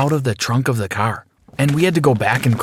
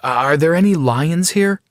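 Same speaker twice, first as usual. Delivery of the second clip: a touch of nerves, pitched higher; nervous question; 6 semitones higher than usual. Acted. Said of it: "I asked nervously".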